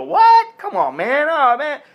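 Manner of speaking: high, loud indignant cry; mock outrage. Guys objecting to advice, in a high mock-indignant cry. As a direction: protest like an offended guy. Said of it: "some guys are gonna go".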